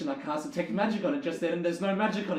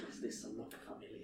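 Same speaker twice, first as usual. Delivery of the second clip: whispered joke; 6 semitones lower, 17 dB quieter than usual. Real, not acted. Joking aside.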